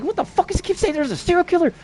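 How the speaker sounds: high-pitched mock outrage; imitated exasperated neighbors. Neighbors react to his yelling.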